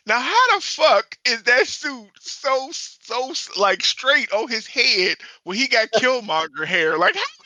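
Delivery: high, loud disbelief; incredulous. Retold or imitated. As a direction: squeal in disbelief. Retold own disbelief.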